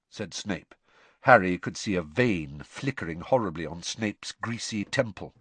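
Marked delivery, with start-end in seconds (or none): none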